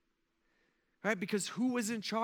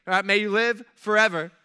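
loud, insistent pledge of support; eager loyalty; 3 semitones higher, 8 dB louder than usual. Acted. Nehemiah assuring the king of loyalty, loud and eager. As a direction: push the flattery out loudly. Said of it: "he compliments him".

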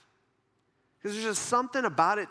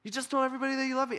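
high, very fast complaint; mock protest; 6 semitones higher, much faster than usual. Jesus reminding God of his love, high rushed protest. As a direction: blurt it in hurt disbelief, hammed-up.

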